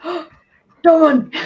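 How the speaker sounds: gasped exclamation, high and loud; excited gasp. Retold or imitated. Fan recalling how they spotted the character, excited.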